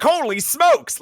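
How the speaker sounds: high-pitched comic-book exclamation; mock amazement. Host mimics another crowded-panel bystander, mock amazement. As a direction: exclaim it in cartoonish astonishment.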